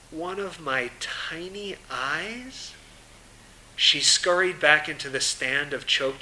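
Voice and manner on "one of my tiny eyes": soft, slow, wondering to herself; hushed and slow, thinking aloud; quiet inner thought. Acted.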